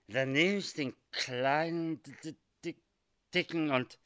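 slurring and stuttering; drunk, stuck on a syllable; drunken stammer. Acted. Drunk man stammers, then collapses.

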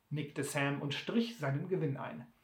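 ordinary delivery

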